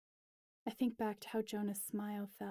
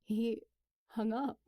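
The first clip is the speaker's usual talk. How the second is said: a touch of tears in the voice; words catching on tears; tearful; 4 dB louder than usual. Acted. Alex telling Steph he hung up, mildly tearful. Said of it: "tears scrambling my words".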